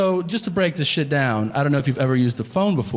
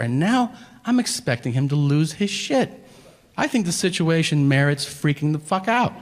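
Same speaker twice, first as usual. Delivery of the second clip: normal talking voice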